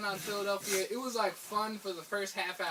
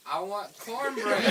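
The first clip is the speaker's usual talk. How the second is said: slow, deliberate order; 4 dB louder, slower than usual. Shown as a demonstration. Ordering again, slowly, from a puzzled waitress. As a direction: spell out I want cornbread slowly. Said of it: "Or maybe I'm not saying it right".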